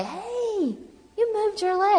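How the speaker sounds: high-pitched mock surprise, drawn-out "hey"; surprised onlooker. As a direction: imitate people exclaiming at a sudden sight, voice way up. People startled by her moving leg.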